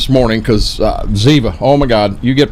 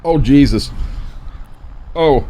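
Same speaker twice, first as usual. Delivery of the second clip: normal talking voice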